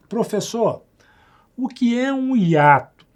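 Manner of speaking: high, slow mock student question; student asking. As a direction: play the curious student asking. Teacher voices a student's question.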